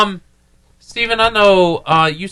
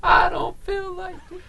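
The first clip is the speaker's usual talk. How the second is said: whiny sick voice; 13 semitones higher, 8 dB quieter than usual. Acted. Sick pet voiced whining.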